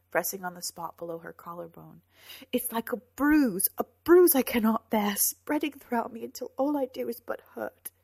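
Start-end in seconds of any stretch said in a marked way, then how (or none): 2.5-7.9 high, loud, pained lament; anguished outpouring. A narrator's reading. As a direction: pour out the hurt. She describes her heartache.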